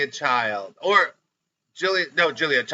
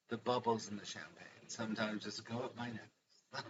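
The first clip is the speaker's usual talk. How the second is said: a hint of a soft, dainty hostess; lightly hushed, prim sigh of a line; Ina Garten impression; 4 semitones lower, 19 dB quieter than usual. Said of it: "That's how she speaks".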